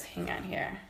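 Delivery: breathy whisper to herself; soft whispered mutter while looking for something; whispered aside. Real, not acted. Searching through her lip products.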